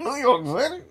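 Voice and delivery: high, loud yelled New York City; mock outraged shout. Mocking the taco ad with a high shout. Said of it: "the taco commercial".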